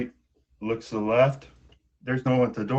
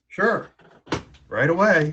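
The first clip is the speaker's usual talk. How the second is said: eager; 6 semitones higher, 4 dB louder than usual. Acted. Eager frog answers the princess.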